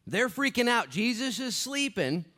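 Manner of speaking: high, loud panic; panicked disciples. Acted. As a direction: cry out as if drowning. Storm scene preached.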